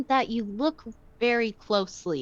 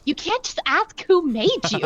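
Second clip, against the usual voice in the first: put-on high, loud snooty mean girl; 6 semitones higher, 6 dB louder than usual. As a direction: scold like a Mean Girls queen bee. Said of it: "it gave me Mean Girls vibes".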